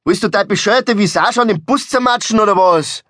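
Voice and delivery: high-pitched, outraged scolding; angry yelling. Acted. Driver berates friend who nearly hit a bus, outraged yelling. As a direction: yell it as a furious rhetorical question. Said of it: "schrie er".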